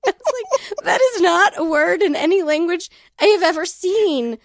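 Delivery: crying with laughter through the words; laughing while telling her husband story. Real, not acted.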